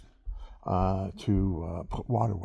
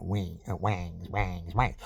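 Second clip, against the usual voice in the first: imitated twang; 3 semitones lower, slower than usual.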